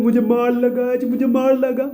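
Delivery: high, far mock-crying child voice; crying little girl. Girl crying to her mother about being hit. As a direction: whimper it like a hurt little girl.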